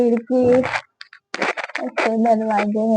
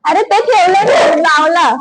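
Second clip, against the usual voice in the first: calling out; 8 semitones higher, 4 dB louder than usual. Real, not acted. Scolding the dog loudly.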